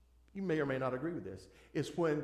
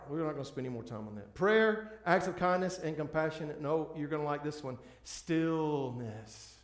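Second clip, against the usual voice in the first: normal talking voice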